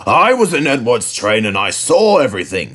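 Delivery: lightly voiced kindly boss; a mild, pleased manager; lightly voiced controller. A narrator's reading. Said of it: "he said".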